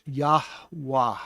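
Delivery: Yahweh slowly as yahh-wahh, two breaths; breath in both syllables. Shown as a demonstration. Teacher putting the syllables together, Yahweh as breathy yahh-wahh. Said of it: "And there's breath in that".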